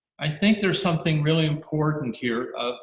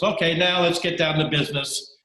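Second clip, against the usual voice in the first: brisk British officer; 5 semitones higher, 5 dB louder than usual. Acted. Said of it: "the British guy says".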